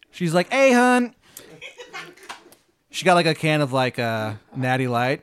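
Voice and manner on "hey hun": loud, high, exaggerated Maryland twang; terrible Maryland accent. Baba Yaga greeting a newcomer, bad Maryland accent. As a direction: holler in a hammed-up Maryland accent. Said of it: "She's got the shittiest Maryland accent".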